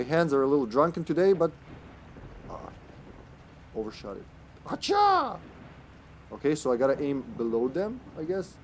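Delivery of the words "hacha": long, high-pitched "hacha" throw cry; mock warrior yelp, drawn out high; high battle cry.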